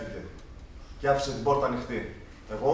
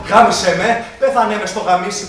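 shouting; 5 semitones higher, 10 dB louder than usual. Retold or imitated. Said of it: "άρχισα να φωνάζω".

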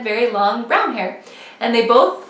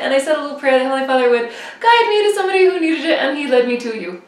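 rushed explanation; 2 semitones higher, faster than usual. Retold or imitated.

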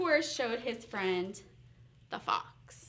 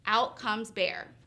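ordinary delivery

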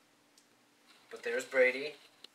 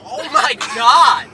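high, loud and slow oh my god; shocked exclamation; 10 semitones higher, 18 dB louder, much slower than usual. Real, not acted. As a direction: blurt it out in shock. Sudden shock in car.

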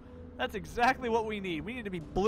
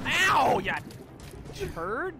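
loud high mock insult; mock yell; 8 semitones higher, 4 dB louder, much slower than usual. Gamer yelling a childish insult at a monster, loud.

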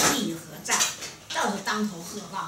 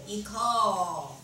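一叩 with the last syllable held long; drawn-out ritual call. Shown as a demonstration. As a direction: stretch out 一叩 like an officiant. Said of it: "都拉长音". (拉长音喊“一叩”)

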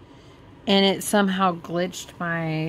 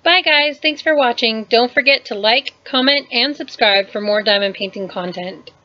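loud, higher vlogger outro; channel sign-off; 4 semitones higher, 7 dB louder than usual. Acted. Thanking viewers and asking them to subscribe, loud outro.

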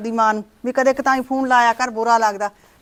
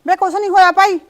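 irritated snap; 5 semitones higher, 4 dB louder than usual. Acted. Snapping back at the son.

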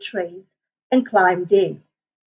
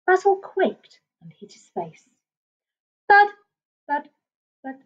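plain reading voice

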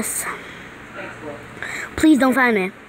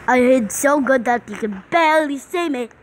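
ordinary delivery

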